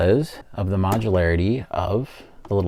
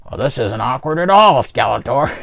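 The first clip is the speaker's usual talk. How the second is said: higher, louder put-on toy voice; awkward villain banter; 5 semitones higher, 7 dB louder than usual. Trapjaw to Skeletor in the acid tub, playful put-on voice. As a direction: play an awkward chat between villains.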